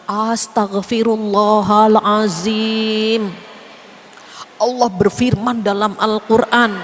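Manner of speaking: slow, loud, over-pious astagfirullah; mock pious exclamation. To friends who gossip, a loud mock-pious rebuke. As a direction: ham up the shocked holy reaction. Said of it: "Nggak usah gitu-gitu juga".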